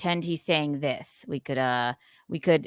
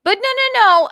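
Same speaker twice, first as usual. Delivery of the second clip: high and loud, laughing protest; flustered no; 11 dB louder than usual. Real, not acted. Streamer flustered by a chat comment, high laughing denial. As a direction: wave it off in a flustered rush.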